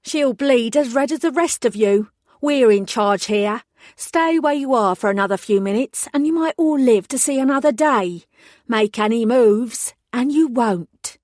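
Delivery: a put-on Cockney snarl at the hostages; fake Cockney accent. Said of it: "her fake Cockney accent".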